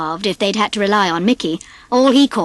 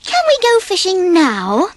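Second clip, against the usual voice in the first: put-on eager kids; 9 semitones higher than usual. A narrator's reading. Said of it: "the boys asked".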